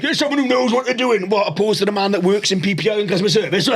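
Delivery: ordinary talk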